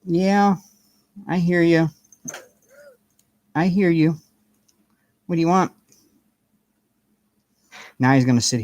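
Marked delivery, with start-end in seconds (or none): 0.1-5.7 talking to the cat. Real, not acted.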